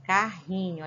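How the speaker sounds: ordinary talk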